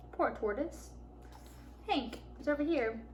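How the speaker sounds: ordinary delivery, nothing marked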